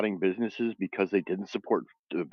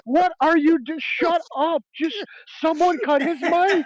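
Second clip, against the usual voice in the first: very high, louder cry of disbelief; incredulous outburst; 3 dB louder, slower than usual. Retold or imitated. Watching Trump's rant, very high incredulous cry. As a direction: squeal it like you can't believe your ears. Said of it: "And I'm like".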